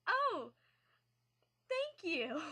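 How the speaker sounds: taken aback, answering a stranger's compliment in a high thanks; flustered high thank you. Retold or imitated. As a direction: caught off guard, thank her in a high voice.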